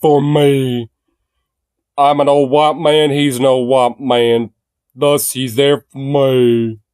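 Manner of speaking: lightly put-on old-man voter voice; slow, drawn-out mocking impression of a supporter.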